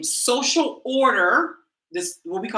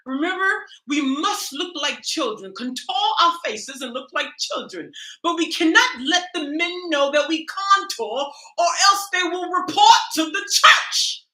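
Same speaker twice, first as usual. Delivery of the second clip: mock British accent; 8 semitones higher than usual. Satirical YouTuber teaching ladies to contour, mock British accent. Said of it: "a British accent".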